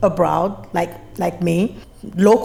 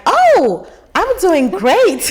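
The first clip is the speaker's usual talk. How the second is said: high, loud, slow and delighted; finally gets it, bursting with relief; relieved understanding; 11 semitones higher, 5 dB louder, much slower than usual. Retold or imitated. Newcomer finally understanding the greeting, high and relieved. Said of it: "Then I'm like".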